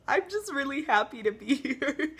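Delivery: happy, laughing while still crying; laughing through tears. Real, not acted. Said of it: "a video in which I cry".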